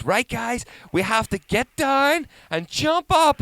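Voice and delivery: high, loud rallying call; slow, drawn-out kids' show command; show host hyping crowd. Retold or imitated. Performer telling the audience to jump, hyping them up.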